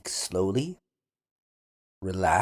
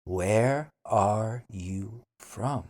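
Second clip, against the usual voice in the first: Where are you from? slowly, Where stressed; slow careful Where are you from?; slow model; slower than usual. Shown as a demonstration. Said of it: "then repeat slowly".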